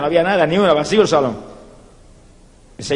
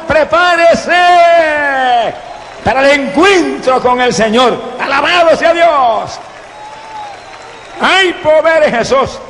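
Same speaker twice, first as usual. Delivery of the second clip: preaching at full force; 8 semitones higher than usual. Real, not acted. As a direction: preach it out with fire. (predicación fervorosa, alta y fuerte)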